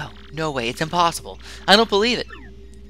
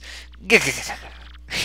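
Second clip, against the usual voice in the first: stupid laugh; 7 semitones higher than usual. Acted. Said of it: "he probably laughs".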